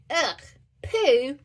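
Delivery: children at fossilised poo, mock disgust; disgusted snort. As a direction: kids going eww in disgust. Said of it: "snorted the other children".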